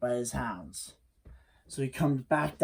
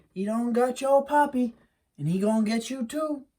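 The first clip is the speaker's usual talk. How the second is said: lightly voiced higher Mammy warning; a hint of a worried mother, pitched up; Mammy warning her boy, lightly voiced higher; Mammy's warning; 8 semitones higher than usual. Mammy warning about the hairy man.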